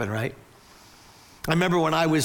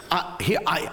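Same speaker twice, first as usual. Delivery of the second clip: usual speaking voice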